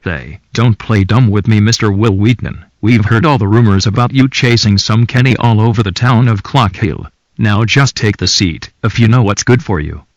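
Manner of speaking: tough angry voice. Acted. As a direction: lean in and threaten loudly. Character threatens.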